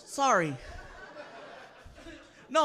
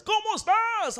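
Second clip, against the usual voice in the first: radio DJ voice; 8 semitones higher, 4 dB quieter, slower than usual. Retold or imitated. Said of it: "same in person".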